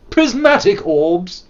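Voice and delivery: slow drawn-out grand incantation; dramatic intonation. Acted. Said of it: "Sorry. It was unnecessary".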